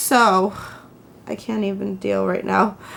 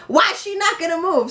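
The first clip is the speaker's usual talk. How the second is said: mock screaming demand, pitched way up; retold screaming; 8 semitones higher than usual. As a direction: imitate an angry woman yelling, without real volume. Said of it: "Screaming at me".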